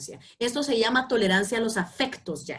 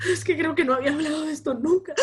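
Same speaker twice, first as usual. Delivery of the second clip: tearful client; 6 semitones higher than usual. Pretend. Voicing a client crying.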